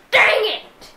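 real gamer rage outburst; frustrated yell. Real, not acted. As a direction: yell it out in exasperation. Frustrated at the game.

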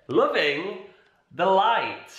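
ordinary talk

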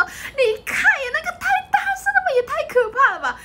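laughing friend. Retold or imitated. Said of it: "就说".

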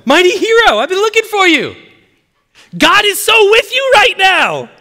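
high, loud hollered greeting; comic angel shout. Acted. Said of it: "I read the Bible with a sense of humor".

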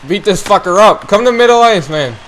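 usual speaking voice

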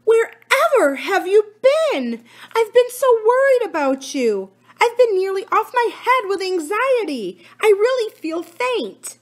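high-pitched alarmed aunt, slowing as she feels faint; alarmed Aunt Polly. A narrator's reading. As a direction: play the frightened aunt close to fainting. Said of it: "in a great state of alarm".

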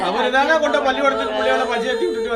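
crying out the loss to the family; loud grieving. Real, not acted. Mourning the father, crying aloud.

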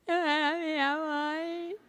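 put-on soft, slow sobbing little child; whimpering child's sob; lost child crying. Shown as a demonstration. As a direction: cry like a lost kid. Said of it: "Choro da criança que se perdeu".

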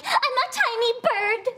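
high, dainty little voice; tiny bird. Retold or imitated. Mocking her own salad order, high and dainty. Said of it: "I'm like".

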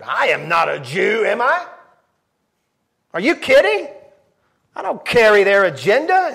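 loud, high indignant retort; indignant Pilate. Acted. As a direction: brush off the accusation. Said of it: "Pilate answered".

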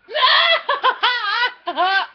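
high, loud burst of laughing; helpless laughter. Real, not acted. Laughing at the pets' antics.

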